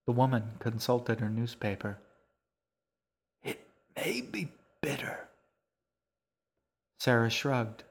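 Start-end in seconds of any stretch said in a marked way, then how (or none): 3.4-5.3 put-on old woman's soft, voiceless whisper. A narrator's reading. Old woman reads Sarah the crossword clue, whispering. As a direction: read it out in a breathy whisper. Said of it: "she whispered".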